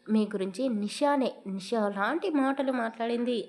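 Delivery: usual speaking voice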